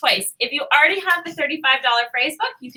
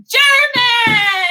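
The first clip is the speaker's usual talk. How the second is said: high, loud burst of delight; thrilled shout of the result; excited shout; 11 semitones higher, 7 dB louder than usual. Real, not acted. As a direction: announce it like a game show win. Random generator lands on German.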